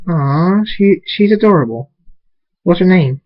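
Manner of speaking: high-pitched adoring coo; sweet, lifted baby-admiring voice. Acted. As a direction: gush softheartedly over a newborn. Cooing over newborn baby.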